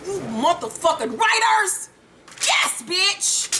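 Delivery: high, drawn-out gleeful praise; thrilled exclamation. Retold or imitated. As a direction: squeal at the writers in delight. Acting out thrilled reaction to episode.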